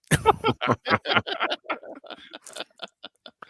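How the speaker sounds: long, high, wheezy laugh. Real, not acted. Co-host after his own furniture joke, long wheezing laugh. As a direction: laugh on and on, mostly breath.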